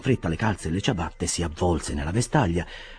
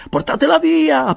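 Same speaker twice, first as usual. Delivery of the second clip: much higher and louder panicked cry; frantic shout; 7 dB louder than usual. A narrator's reading. Winkle frantically shouting to the bearers, high panicked yell. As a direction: yell for them to take it away. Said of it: "gridava mister Winkle".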